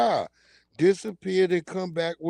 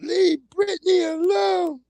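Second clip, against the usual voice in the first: high wailing plea; 12 semitones higher, slower than usual. Retold or imitated. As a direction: plead tearfully like the famous fan video. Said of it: "they were screaming".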